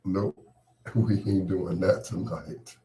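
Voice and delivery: ordinary delivery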